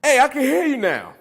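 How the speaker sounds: high, loud phone holler; acted calling out. Man acting out his first iPhone call, hollering. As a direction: holler it out as if the line just cleared.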